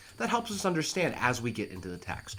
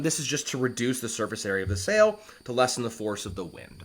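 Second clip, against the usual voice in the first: normal talking voice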